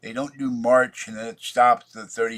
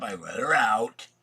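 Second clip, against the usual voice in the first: ordinary talk, nothing marked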